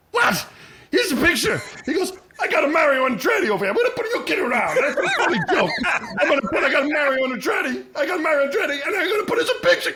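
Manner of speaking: high, loud, laughing shouts, fast and excited; excited Italian pizza man. Retold or imitated. Pizza owner laughing off the astronaut photo, loud and high. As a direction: burst out laughing like the little Italian guy. Filippo's excited broken-English outburst.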